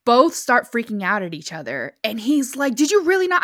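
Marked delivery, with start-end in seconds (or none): 2.8-3.4 heated. Retold or imitated. Retold argument in the car.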